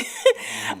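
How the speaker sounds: short high nervous laugh; a quick embarrassed laugh. Real, not acted. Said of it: "I'm nervous".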